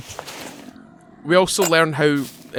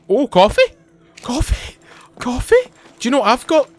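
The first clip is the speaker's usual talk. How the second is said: high, rising questions, climbing each time; light up at a sudden find, pitch jumping up; excited surprise; 10 semitones higher, 4 dB louder than usual. Real, not acted. Streamer spots coffee mid-story, excited rising surprise.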